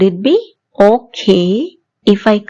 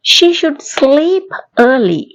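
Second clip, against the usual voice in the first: slow and higher, each word separate; slow model sentence; 5 semitones higher, much slower than usual. Shown as a demonstration. Teacher slowly modelling a sentence, higher, for the class. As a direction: lift and slow it for the learners. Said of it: "மறுபடியும் சொல்லி பாப்போமா".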